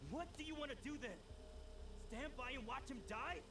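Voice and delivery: agitated challenge. Acted. Challenging the plan to let him die, agitated and fast. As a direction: push back hard, voice climbing. Confronting the scientist over serum.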